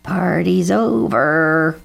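slow, stretched cawed announcement; cawing crow. A narrator's reading. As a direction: caw it out, dragging the vowels. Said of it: "cawed Bernie Crow".

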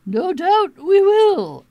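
high, loud, slow and knowing; smug kangaroo. A narrator's reading. Kangaroo having the last word with Sylvester, high and slow. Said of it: "said the kangaroo".

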